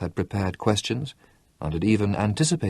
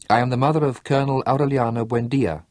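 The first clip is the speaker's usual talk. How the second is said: firm announcement; 4 semitones higher than usual. A narrator's reading. Mother announces herself to the sentries, firmly. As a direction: declare it, head high, to the sentries. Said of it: "she announced".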